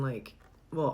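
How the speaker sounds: ordinary talk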